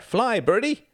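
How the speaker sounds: put-on high, loud boyish call; excited cry. A narrator's reading. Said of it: "Reginald cried out".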